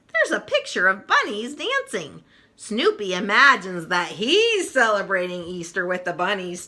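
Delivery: ordinary delivery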